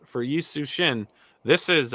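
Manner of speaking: usual speaking voice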